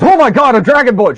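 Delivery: high, loud fake gasp of surprise; mock shock. Selkie teasing the Dragonborn with mock surprise. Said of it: "Selkie looks back and goes".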